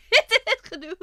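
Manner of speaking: high, loud laugh into the words. Real, not acted. Streamer embarrassed after being understood, laughing. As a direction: giggle out an embarrassed exit.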